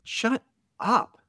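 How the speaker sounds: a high, hammed-up "Shut up"; mock boss brush-off. Boss waving off the editor's excuses, high mock snap.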